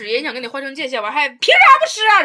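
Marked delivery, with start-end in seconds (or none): 1.3-2.2 much higher and louder indignant snap; imitating the boy flaring up over mooncakes; mock angry outburst. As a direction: burst out like a sulking boy. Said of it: "你买的东西人家不想吃".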